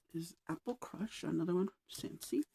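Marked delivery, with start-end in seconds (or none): none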